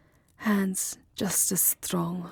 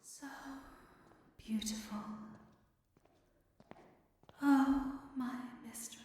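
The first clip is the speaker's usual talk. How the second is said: very soft, higher, halting near-whisper; hushed adoration; 4 semitones higher, 8 dB quieter than usual. Acted. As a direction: murmur haltingly over a sleeping beloved. Adoring the sleeping beloved, very softly.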